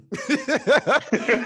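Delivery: a burst of high, bright laughter; real laugh. Real, not acted. Laughing while telling a friend the pool story. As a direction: laugh out loud at the memory.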